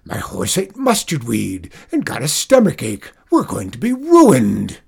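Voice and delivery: loud, distraught farmer's shout, building to a peak. A narrator's reading. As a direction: bellow ruin at the wife, hammed-up. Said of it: "Zeke shouted to his wife".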